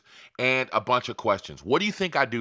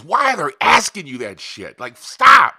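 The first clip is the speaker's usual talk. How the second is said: frustrated outburst; 2 semitones higher, 11 dB louder than usual. Real, not acted. Vents at affiliate networks' forms, loud frustrated shout. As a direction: blow up at the pointless questions. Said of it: "Can you hear the frustration in my voice?".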